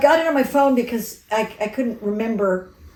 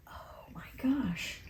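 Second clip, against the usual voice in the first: hushed, breathless oh my gosh; a retold stunned whisper; whispered gasp; 3 semitones higher, 17 dB quieter, much slower than usual. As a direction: whisper it in sudden realization. Retelling a moment of realization.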